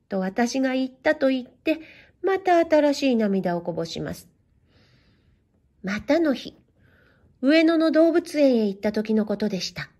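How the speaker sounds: usual speaking voice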